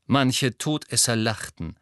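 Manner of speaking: ordinary delivery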